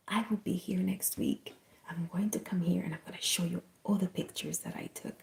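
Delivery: soft, breathy near-whisper; hushed, unhurried reassurance; tender promise. Retold or imitated. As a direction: promise it gently at a bedside. Retold promise to dying man.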